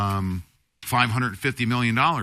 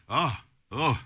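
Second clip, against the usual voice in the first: recovering from coughing; 4 semitones higher than usual. Real, not acted. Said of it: "went down the wrong pipe".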